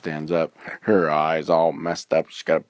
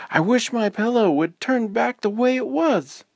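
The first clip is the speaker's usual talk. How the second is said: wishing together; 6 dB louder than usual. Acted. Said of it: "both at the same time".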